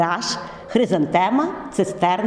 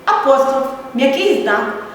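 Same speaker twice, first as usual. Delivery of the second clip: upbeat closing address; 3 semitones higher than usual. Acted. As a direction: send the students off with encouragement. Teacher's closing summary to students.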